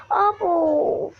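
high eager exclaim, slowing on the want; sudden rush of wanting the item; excited. Real, not acted. Gamer spotting a new shop item, excited.

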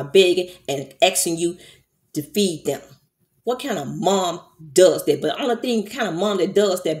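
real heated scolding, voice pushed up on key words; worked-up angry rant. Real, not acted. Vlogger berating a mother over hungry kids, emotional and angry. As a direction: lay into her, emotional and indignant. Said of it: "I'm getting emotional".

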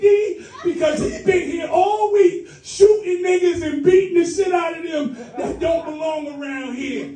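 high-pitched, gushing woman's voice; relieved desk clerk. Retold or imitated. Post office clerk thanking a supposed cop, high and gushing. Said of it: "So the chick behind the desk said".

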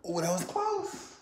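high, drawn-out "oh" of suspense; excited near-miss. Real, not acted. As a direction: react to a shot that just misses. Nearly makes basketball shot.

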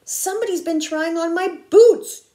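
indignant bear's loud complaint; booming Papa Bear. A narrator's reading. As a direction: boom it out, landing hard on the last word. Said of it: "he boomed".

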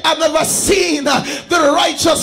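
loud, rising sermon climax; loud preaching over the music; preaching at full force. Acted. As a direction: drive the congregation to praise.

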